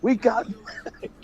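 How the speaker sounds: high-pitched, re-enacted thrill; retold excitement. Fan telling his girlfriend about Mac, retold high-pitched excitement.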